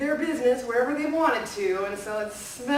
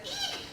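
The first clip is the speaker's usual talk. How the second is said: mouse shriek; 7 dB quieter than usual. Acted. Mice see cats.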